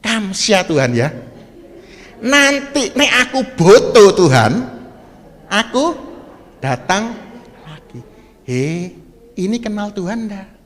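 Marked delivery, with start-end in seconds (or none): 0.0-8.0 louder, higher breezy thank-you; mock careless prayer to God; casual thanks. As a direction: toss it off like a flippant churchgoer. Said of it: "Ngomongnya gini".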